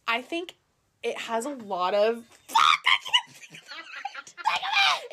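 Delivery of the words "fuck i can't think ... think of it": high exasperated yell; frustrated shout. Real, not acted. Can't find the word.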